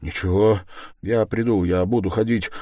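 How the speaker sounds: ordinary delivery, nothing marked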